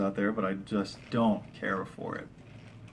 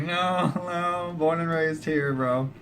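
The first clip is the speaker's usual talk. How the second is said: retold brush-off; 7 semitones higher, slower than usual. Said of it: "I'm like".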